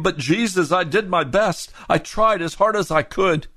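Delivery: high, pleading excuse; excusing sinner. Retold or imitated. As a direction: whine your defense to Jesus. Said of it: "you say to him".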